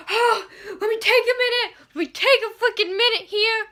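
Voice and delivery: high, laughing, breathless; overwhelmed squeal. Retold or imitated. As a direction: gasp it out, needing a moment. Overwhelmed after twist.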